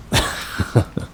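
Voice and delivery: short breathy laugh at them; mocking laugh. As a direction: scoff a laugh at the amateur. Mocks botched TikTok spell.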